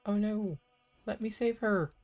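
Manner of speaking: high, loud panicked gamer shout; alarmed cry. Real, not acted. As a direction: yell it in a rush to rescue her. Alarmed gamer in tough fight.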